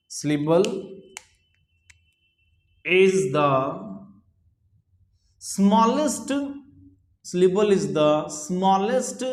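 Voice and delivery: very slow, drawn-out words with long pauses; slow dictation. Real, not acted. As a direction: dictate it phrase by phrase for note-takers. Teacher dictating a definition. (बहुत धीरे, रुक-रुककर लिखवाते हुए)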